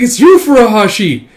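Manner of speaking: high, loud surprised cry; excited shout. Acted. Comrade shouting as Furuhashi arrives, excited loud cry. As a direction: call out his name in relief.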